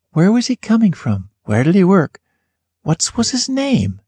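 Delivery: lifted, wondering questions; curious wondering. Acted. Narrator wondering about the man on the train, curious. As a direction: muse aloud, curious.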